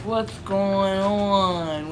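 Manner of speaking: very slow, drawn-out, groggy friend; slow stoner drawl. Retold or imitated. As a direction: stretch every word as if just waking up, confused. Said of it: "he has this real slow talk about it".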